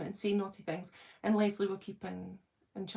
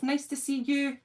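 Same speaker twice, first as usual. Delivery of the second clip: usual speaking voice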